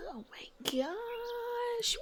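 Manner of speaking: high, very slow soft gasp of delight; surprised. Real, not acted. Gamer reacting softly to a find in the game, surprised. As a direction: draw out a high "oh my gosh".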